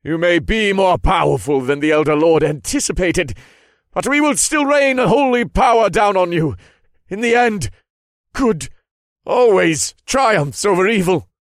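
high, loud righteous tirade; defiant cry. A narrator's reading. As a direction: proclaim it defiantly at full voice. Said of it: "he cried out".

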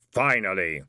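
low, hollow Death voice; Death's voice. A narrator's reading. Death welcoming the moment, low and hollow. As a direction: say it as Death, deep and satisfied.

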